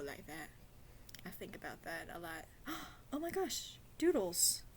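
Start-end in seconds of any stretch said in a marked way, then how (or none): 2.5-3.0 sharp little gasp of delight; surprised gasp. Real, not acted. Gasping on finding the doodles page in the sketchbook. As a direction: gasp as if spotting something great.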